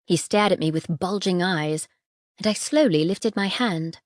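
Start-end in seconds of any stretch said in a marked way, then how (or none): none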